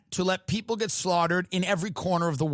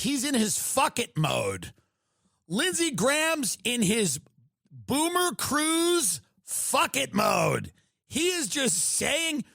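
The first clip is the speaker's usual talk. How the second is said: usual speaking voice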